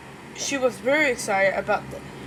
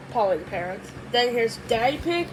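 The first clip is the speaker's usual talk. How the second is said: plain reading voice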